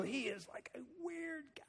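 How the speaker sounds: high, hushed imitation of a doubting listener; a hint of a sceptical listener; mocking skeptic. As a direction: lightly mimic someone calling him weird. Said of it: "'cause you're gonna go".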